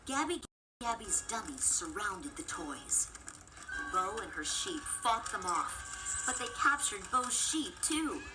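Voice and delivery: usual speaking voice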